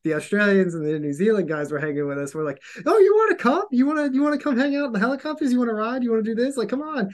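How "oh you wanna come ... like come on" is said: high-pitched, excited "come along" offer; acted-out gushing welcome; eager invitation. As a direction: invite your favourite mates, high and keen. Eagerly inviting favoured allies along.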